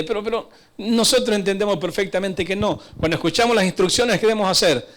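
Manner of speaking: ordinary delivery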